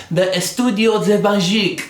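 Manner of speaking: playful put-on European accent for the studio name; a hint of a hammed-up Spanish-French accent; mock fancy foreign accent. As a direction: announce it like a fancy foreign brand. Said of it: "I don't know why I'm using that accent".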